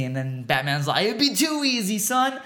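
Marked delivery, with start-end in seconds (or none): 1.1-2.4 high-pitched, hammed-up comeback; mock dramatic retort. Acted Batman retort in retold scene.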